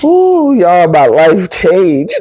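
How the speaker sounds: overcome. Real, not acted. Telling the audience her life changed, overcome with emotion. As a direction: push the words out through tears. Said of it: "Oh, I got emotional".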